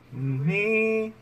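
ordinary talk, nothing marked

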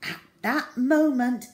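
plain reading voice

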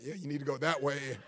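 very low, soft, amused mumble; muttered comic aside. Retold or imitated. Preacher jokes about pointing people with a severed finger, muttered aside. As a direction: toss it off under your breath, half laughing.

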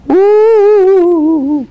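long, high, loud "woooo"; wailing cave. A narrator's reading. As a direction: howl it out like a haunted cave. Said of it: "cried the deep, dark, mysterious".

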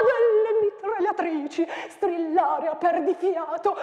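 declaimed with wild swings of pitch and loudness; dramatic poem recital. Acted. Teacher performing the battle poem for students, declaimed.

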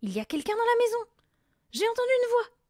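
high, loud panic; alarmed cry. A narrator's reading. Mother fearing an intruder in the house, alarmed cry. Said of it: "s'écria la mère".

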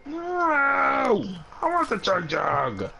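high, drawn-out whiny voice; mock whine. Mocking a teammate who wants the Chug Jug, high whine. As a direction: stretch it out like a whining kid.

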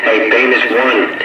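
ordinary delivery

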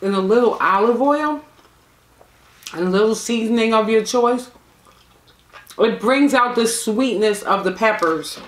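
chewing between words; talking while eating; mouth partly full. Real, not acted. Seen 0: Fingers at lips, then lips pressed and jaw moving between phrases.

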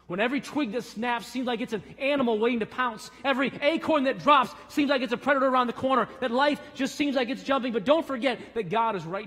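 high, loud, fast shouting build; shouted preaching climax. Acted. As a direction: drive it to a shouting peak. Climax of sermon illustration.

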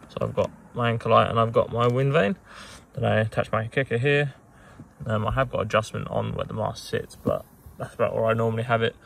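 ordinary delivery

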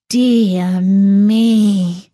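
drawn-out, mildly dismayed sigh of words. Acted. Dog shaking her head at the chick, dismayed. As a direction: stretch it out in mild despair.

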